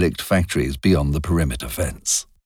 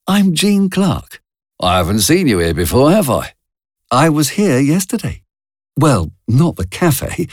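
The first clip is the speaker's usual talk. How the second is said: put-on a young woman's voice, pitched clearly higher; Jean's higher voice; 8 semitones higher than usual. A narrator's reading. Jean telling Charlie about the theatre, higher young voice. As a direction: voice Jean light and chatty, higher up.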